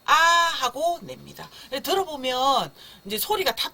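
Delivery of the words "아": long, loud "아" with mouth wide open. Shown as a demonstration. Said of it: "입을 크게 벌리면서 소리는". (입을 크게 벌려 길게 내는 "아")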